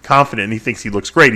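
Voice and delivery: usual speaking voice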